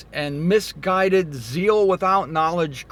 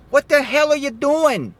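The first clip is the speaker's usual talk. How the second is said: exasperated; 9 semitones higher, 5 dB louder than usual. Real, not acted. Scolding Passover-keepers.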